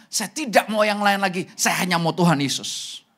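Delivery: loud, proud declaration of devotion; full of pride. Shown as a demonstration. Congregation declaring they want only Jesus, loud and proud. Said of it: "dengan penuh kebanggaan".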